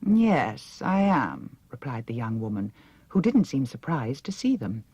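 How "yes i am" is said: haughty princess. A narrator's reading. Mombi's voice answers.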